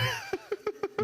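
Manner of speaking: sudden high laugh; a quick high real laugh; burst of laughter. Real, not acted. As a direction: laugh out at the joke. Laughs at the AP class joke.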